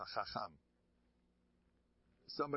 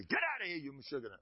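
mock angry outburst; 5 semitones higher than usual. Rabbi rehearsing his outburst at the gabbai, mock angry. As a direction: rehearse throwing someone out in outrage.

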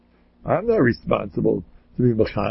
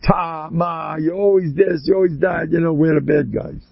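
ordinary delivery

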